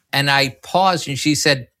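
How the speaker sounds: ordinary talk, nothing marked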